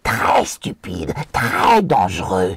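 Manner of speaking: créature qui étire ses reproches; Gollum réprobateur. Acted. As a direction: gronder les hobbits d'une voix de créature. (Gollum réprobateur, voix contrefaite)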